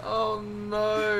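high, loud wail of mock horror; loud, drawn-out dismayed outburst; loud groaning dismay. Real, not acted. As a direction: cry out at an awful joke. Dismay at a bad joke.

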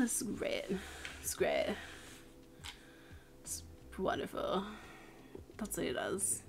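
hushed whispered aside; whispering. Acted. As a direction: drop to a whisper. Whispered asides about the Sim.

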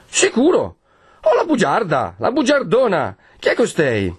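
voce molto più acuta, indignata e stizzita; Perpetua indignata. Acted. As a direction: scatta offesa per la bugia. (Perpetua offesa che sbotta contro la bugiarda)

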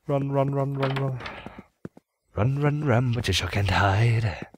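taunting menace. Pretend. Taunting mimic of game threat.

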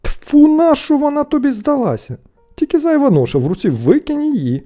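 high, loud, disgusted outburst; scornful scolding. A narrator's reading. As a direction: tell off your foolish brother. Brothers scorn the find.

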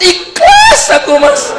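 very high voice breaking into laughter; high squeaky laugh through the words; laughing wife. Acted. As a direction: give up the act laughing.

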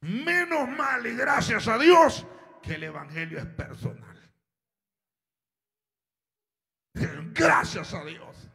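fervent preacher's climax; full preaching. Acted. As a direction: build to a shouted praise.

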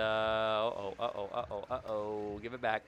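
normal talking voice

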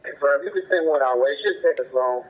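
ordinary talk

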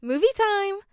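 high, loud, happy shout; happy announcement. A narrator's reading. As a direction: call out cheerfully that it's finished. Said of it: "she announced happily".

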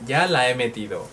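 normal talking voice

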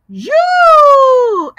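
you said high and loud; model the word. Shown as a demonstration. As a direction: call out you for the class to copy. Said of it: "We have".

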